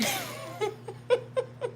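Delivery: airy high giggle; amused high giggle; high soft laugh. Real, not acted. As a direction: squeal a little laugh. Laughs at her own line.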